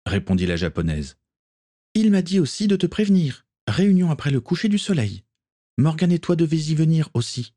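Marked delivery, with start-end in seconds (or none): 1.9-3.5 put-on much higher, calm young woman passing on orders; Akiko's voice. A narrator's reading. Akiko passing Gaël's orders to John, high and light. 3.7-5.2 much higher, calm relayed order; Akiko's voice. A narrator's reading. Akiko relaying the captain's order to John, high voice. 5.8-7.5 put-on much higher, calm young woman passing on orders; Akiko's voice. A narrator's reading. Akiko passing Gaël's orders to John, high and light.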